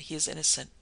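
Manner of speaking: ordinary delivery, nothing marked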